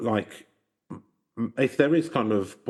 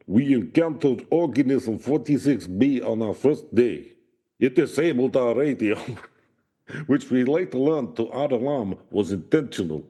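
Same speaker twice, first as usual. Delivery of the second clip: put-on Russian accent; 4 semitones higher than usual. Reading the doctor's account to co-host, hammed-up Russian accent. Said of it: "I can do a Russian accent".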